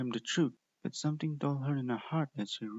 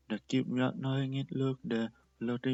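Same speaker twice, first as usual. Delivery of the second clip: ordinary delivery, nothing marked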